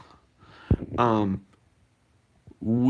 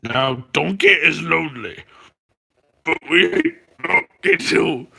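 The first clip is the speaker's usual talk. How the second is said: loud, raised ogre impression; Shrek; 4 semitones higher, 5 dB louder than usual. Streamer reads the Donkey poem aloud, attempting a Shrek voice. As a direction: declaim it in a put-on ogre voice. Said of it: "I tried to sound like Shrek".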